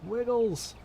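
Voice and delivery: high, silly singsong wiggle; playful high singsong. Pretend. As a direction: bounce it up high like a kid.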